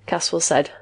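ordinary delivery, nothing marked

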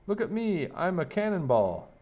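put-on high-pitched storybook boy's shout; playful shout. A narrator's reading. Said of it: "he shouted".

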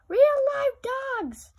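very high, loud, slow panicked cry; panicked skeletons. A narrator's reading. Skeletons realising real dogs are coming, very high and panicked. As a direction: shriek in terror at the dogs.

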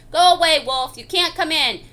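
ordinary delivery, nothing marked

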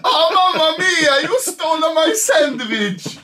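mock Italian, mamma mia style; Italian accent. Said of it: "He's just Italian".

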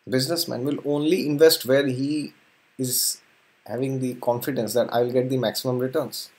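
ordinary delivery

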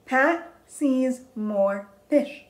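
slow, deliberate teacher read-aloud; model reading. Shown as a demonstration. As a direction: read each word separately for a beginner. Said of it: "I'll read first".

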